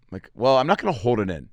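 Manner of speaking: ordinary delivery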